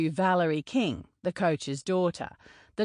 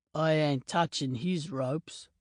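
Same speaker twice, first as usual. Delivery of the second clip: a hint of a lower, gruff giant mocking; lightly voiced big Maori teammate; 3 semitones lower, 4 dB quieter than usual. Donovan mocking Ronan's celibacy pledge, a lightly lower teasing voice. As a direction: slightly lower voice, teasing the boastful American.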